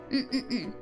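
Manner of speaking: normal talking voice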